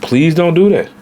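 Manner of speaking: normal talking voice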